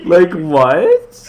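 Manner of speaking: loud, high disbelief; what on earth, out loud; bewildered. Real, not acted. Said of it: "laughed so hard".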